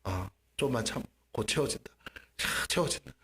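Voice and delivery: low, hushed reassurance sinking to a whisper; inner voice. Retold or imitated. God reassuring the believer inwardly, hushed. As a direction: murmur it softly, nearly voiceless at the end. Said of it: "마음속에서 뭐라고?".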